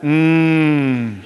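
low, long prayerful "mm"; drawn-out low hum like a cow; imitated mooing hum. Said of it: "someone starts mooing".

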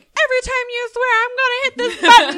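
high, loud mock announcement; retold childish warning. Child warning parents about their swearing, high loud declaration.

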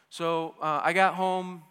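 ordinary delivery

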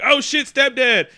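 loud, high, slowed mock shout; retold alarmed yell. Jake kicking in the door upstairs, retold alarmed yell. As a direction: call it out in hammed-up alarm.

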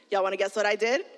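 ordinary talk

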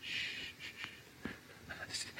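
put-on soft, breathy shushing like calming a child; hushing. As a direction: hush them gently, barely voiced. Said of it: "And he's".

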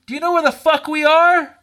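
high, heated challenge; retold indignant yell; confrontational. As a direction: demand it like you're squaring up. Retold confrontation with angry man.

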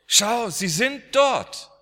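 urging mother to look at the ceiling; urgent wonder. Retold or imitated. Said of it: "zeigte an die Zimmerdecke und er sagte". (drängend und staunend, deutlich höher und lauter)

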